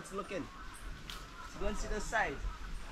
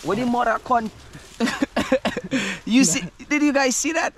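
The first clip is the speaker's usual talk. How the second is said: surprised shouting; 7 semitones higher, 10 dB louder than usual. Real, not acted. Startled by the iguana.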